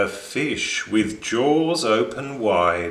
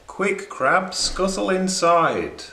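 quick, higher, eager hurry-up; urgent urging; 8 semitones higher than usual. A narrator's reading. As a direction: hurry the crab along, pitched up. Narrator urging the crab.

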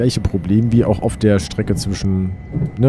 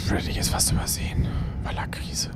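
whispering; 8 dB quieter than usual. Real, not acted. Unvoiced whisper while filming.

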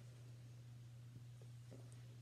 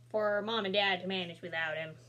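plain reading voice

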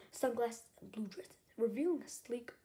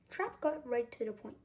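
ordinary delivery, nothing marked

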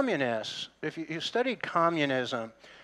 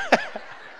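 laughing. Real, not acted. After telling the story.

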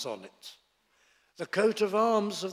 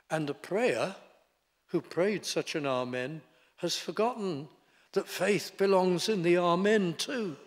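normal talking voice

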